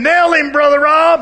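a voice in his head urging him to hit the man; egging on. Retold or imitated. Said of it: "a guy living over here".

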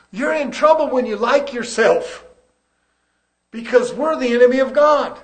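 impassioned pulpit delivery; forceful preaching. Real, not acted. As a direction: preach it loudly with urgency.

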